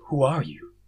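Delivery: ordinary delivery, nothing marked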